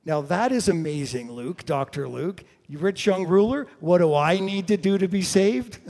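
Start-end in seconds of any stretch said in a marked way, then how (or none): 2.7-5.8 loud, higher retold question breaking into a laugh; mock ruler's question. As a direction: put the question loudly and high. Voices the rich young ruler's question.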